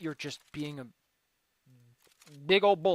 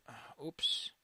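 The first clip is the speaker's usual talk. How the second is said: whispered oops; 8 semitones lower, 11 dB quieter, much slower than usual. Real, not acted.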